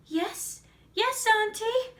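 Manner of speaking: put-on high, loud little-girl answer; high-pitched dutiful shout, rising at the end; girl calling back. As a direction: call brightly to the next room. Girl calls back to Baba Yaga.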